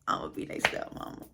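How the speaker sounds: whispered scheming to herself; secret aside. Acted. Woman dreaming of marrying the dad, secretly whispering. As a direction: whisper it like a private daydream.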